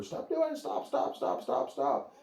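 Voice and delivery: high, frantic stop stop stop; mock hollering at dog. Owner imitated yelling at the barking dog, high and frantic. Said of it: "we start hollering at the dog".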